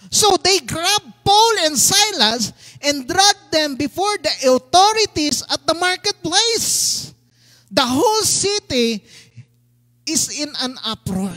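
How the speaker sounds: slow, forceful preacher's reading; scripture reading. A narrator's reading. Pastor reading of Paul and Silas dragged away, emphatic. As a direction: punch the key words.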